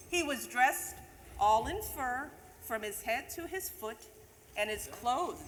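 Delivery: lively storybook reading of a Christmas poem; a teacher reading The Night Before Christmas to the class; reading to children. Acted. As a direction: read it like a bedtime story to kids.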